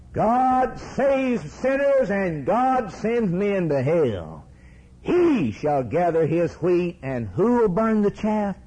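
high, drawn-out pulpit proclamation; fiery preaching. Real, not acted. Preacher answers doubters about hell, full-force preaching. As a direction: proclaim it high and slow, driving to a question.

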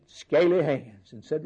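usual speaking voice

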